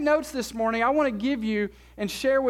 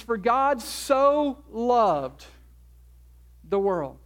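slow scripture quote; much slower than usual. Acted. Quoting John three sixteen.

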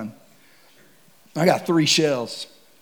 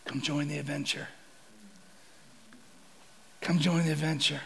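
gentle invitation; 13 dB quieter than usual. Retold or imitated. Voicing God's invitation.

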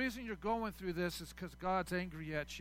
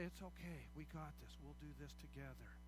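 soft, quieter reassurance; gentle comfort; 5 semitones lower, 15 dB quieter than usual. Retold or imitated. A friend comforting someone who shared their heart, softly and gently. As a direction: soothe a hurting friend softly. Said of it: "just say".

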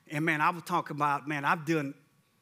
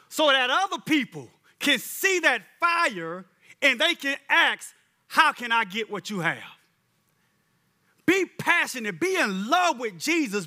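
louder, higher passionate preaching; 5 semitones higher, 4 dB louder than usual. Acted. Preacher urging the congregation to passion, fiery and loud. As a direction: stir them up, rising with fire.

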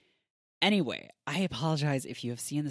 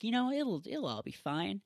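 tag mellowed-out retelling; 7 semitones higher than usual. Retold or imitated. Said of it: "starts acting really differently".

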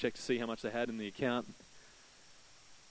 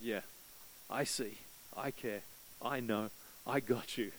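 God's reassurance; 3 semitones lower, 9 dB quieter than usual. Retold or imitated. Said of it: "And God said".

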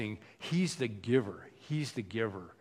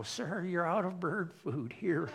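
put-on high, slow, softer old-lady voice; drawn-out, higher-pitched mock old lady; little old lady; 4 semitones higher, 5 dB quieter than usual. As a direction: imitate a little old lady complaining politely. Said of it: "the little old ladies would come up and they go".

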